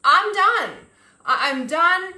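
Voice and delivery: loud, high outburst; snapping "I'm done". Retold or imitated. Fed up with a toxic situation, she snaps loudly. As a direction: blurt it out like you've finally snapped. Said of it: "you snap and you're like".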